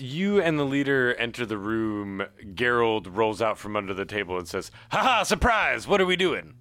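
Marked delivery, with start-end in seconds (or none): 4.9-6.5 high, loud and gleeful; excited surprise. Acted. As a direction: pop out from under the table, thrilled. Geralt jumps out from hiding.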